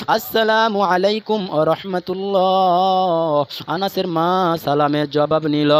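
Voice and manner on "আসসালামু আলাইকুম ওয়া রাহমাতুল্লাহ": slow chanted salam; lengthened, melodic salutation; long-drawn greeting. Acted. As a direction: stretch the greeting out to its last syllable. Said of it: "লম্বা করে সালাম দিল".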